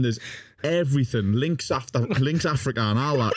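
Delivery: ordinary delivery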